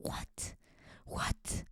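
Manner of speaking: whispered aside; hushed unvoiced what. Real, not acted. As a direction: breathe the word with no voice. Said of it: "aside whisper".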